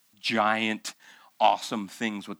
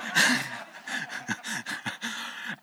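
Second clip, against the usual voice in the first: laugh. Real, not acted. Pastor laughs at his own story.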